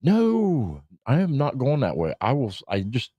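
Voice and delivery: high, heated refusal; indignant no to the route; refusing angrily. Retold or imitated. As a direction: flatly refuse the GPS, worked up. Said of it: "And I was like".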